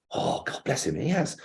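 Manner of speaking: whispered, half to himself; hushed aside. Retold or imitated. Reading Gatiss's praise to himself, whispered. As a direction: breathe it out as a touched aside.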